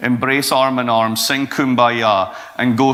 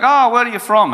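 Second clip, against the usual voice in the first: taxi driver asking; 10 semitones higher, 4 dB louder than usual. Retold or imitated. Taxi driver quizzing speaker.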